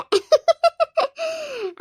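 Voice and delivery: long, high-pitched laugh; high gloating laughter; gleeful cackle. Acted. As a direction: cackle triumphantly over the trick. Gloating with grandchild afterwards.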